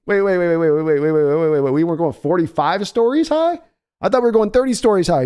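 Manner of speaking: high, rapid, panicky protests; hammed-up panic, pitched high; mock panic. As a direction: act the scared rider. Panicked rollercoaster rider.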